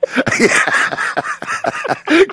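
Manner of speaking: high breathy chuckle; real laugh. Real, not acted. Host laughing at the hard-hat joke, breathy chuckle.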